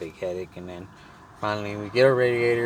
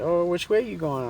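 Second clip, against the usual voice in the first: high-pitched question as her; imitated woman; 10 semitones higher, 4 dB louder than usual. Woman asks his direction, imitated high. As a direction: lift the pitch right up for her. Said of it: "she said".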